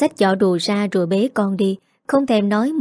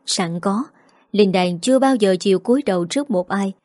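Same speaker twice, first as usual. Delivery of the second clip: usual speaking voice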